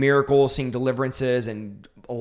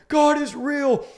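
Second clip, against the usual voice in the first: high-pitched amazed outburst, acted; retold freaking out; 13 semitones higher, slower than usual. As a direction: relive the freak-out in a much higher voice. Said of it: "And I'm freaking out".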